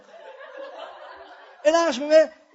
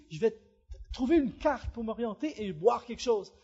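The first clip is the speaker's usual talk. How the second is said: ordinary delivery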